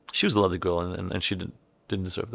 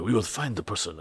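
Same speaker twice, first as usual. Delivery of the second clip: toned-down guard; 5 dB quieter than usual. Retold or imitated. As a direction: rein in the acting, sound hesitant. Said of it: "not too much overacting".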